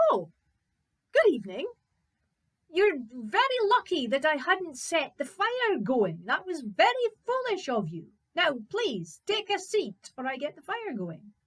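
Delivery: high, loud and emphatic hero voice; undaunted young Fritz, scolding. Acted. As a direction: tell off a giant without any fear. Fritz undaunted, addressing the giant.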